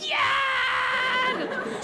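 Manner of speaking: put-on high, loud, drawn-out kid's scream; victory scream. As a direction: shriek with triumph like a small boy. Boy's victory lap.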